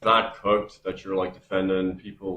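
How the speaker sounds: normal talking voice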